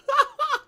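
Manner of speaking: loud burst of laughter; high laugh. Real, not acted. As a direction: cackle after the outburst. Laughs at own outburst.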